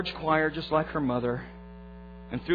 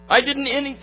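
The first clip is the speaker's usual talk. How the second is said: ordinary talk, nothing marked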